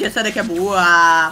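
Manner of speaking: normal talking voice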